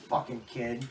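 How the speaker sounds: ordinary delivery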